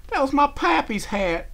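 high, loud, slow lament; tearful sobbing. A narrator's reading. Applejack explaining the hat was her pappy's, sobbing. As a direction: lament it as if through tears. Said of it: "she sobbed".

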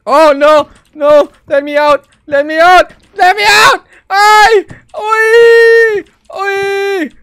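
very high, loud frantic cries; panicked screaming. Real, not acted. Trapped player begging to be let out, panicked screaming.

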